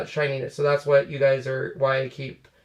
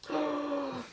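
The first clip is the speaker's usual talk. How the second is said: sharp gasp; 11 semitones higher, 11 dB quieter than usual. Real, not acted. Dog grabs something.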